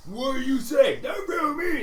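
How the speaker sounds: high, loud ogre challenge; angry ogres. Acted. The ogres turning on the newcomer, high and loud. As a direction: snap back like a riled ogre. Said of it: "they're like".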